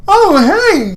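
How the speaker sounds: high, bright startled hey; surprised greeting. Acted. Heroine startled by Lance at her door, high. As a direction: caught off guard at the door.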